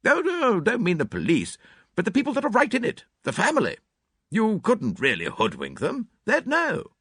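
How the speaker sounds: put-on high, brisk, blunt older lady; clipped spinster, sure of herself; Miss Howard. A narrator's reading. Miss Howard voiced in reading.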